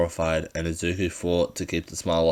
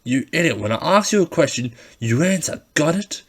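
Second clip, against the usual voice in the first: loud, higher angry demand; threatening bully; 9 semitones higher, 6 dB louder than usual. Acted. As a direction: bark it down at him. Katsuki threatening Izuku.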